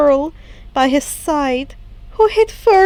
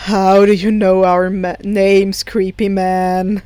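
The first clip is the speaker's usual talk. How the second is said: low, slow, louder challenge; suspicious teen; 6 semitones lower, 3 dB louder than usual. Acted. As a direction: call him out flatly.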